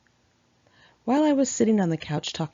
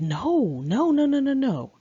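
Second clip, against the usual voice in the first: rising, louder run of no's, each one higher; firm refusal; 8 semitones higher, 5 dB louder than usual. Retold or imitated. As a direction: fend him off with a string of no's. Refusing his kisses, repeated no.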